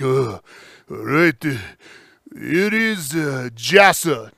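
put-on Japanese accent, halting, from the gut. Shown as a demonstration. Comic showing how Japanese people talk, demonstrated Japanese accent. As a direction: demonstrate a Japanese accent, last word drawn out. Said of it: "so I can do this accent".

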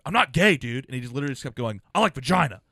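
high, loud macho insisting; mock defensive yell. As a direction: bark it back like a threatened bro. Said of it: "was like".

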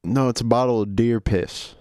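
sheepish Southern son; Southern accent. Acted. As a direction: admit the mistake with a Southern accent. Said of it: "Southern accents?".

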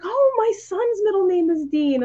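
mock surprised actor; quoted actor surprised. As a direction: lift it up in happy wonder. Actor answering, surprised delight.

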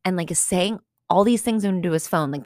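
ordinary delivery

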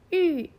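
"芋" said as Mandarin yù, loud, falling from high; fourth-tone ü. Shown as a demonstration. Teacher quotes today's dialogue, "芋" as falling fourth-tone yù. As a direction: drop "芋" sharply from a high start, lips rounded. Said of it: "この発音で四声で発音".

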